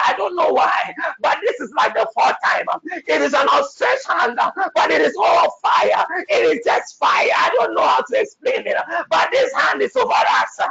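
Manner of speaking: high, urgent preaching of a vision; excited vision. Real, not acted. Prophet describing a hand of fire, urgent high preaching. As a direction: describe it in awe, urging.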